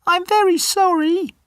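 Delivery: very high squeaky little mouse; tiny mouse voice. Acted. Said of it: "says the mouse".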